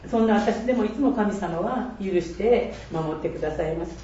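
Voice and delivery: ordinary talk, nothing marked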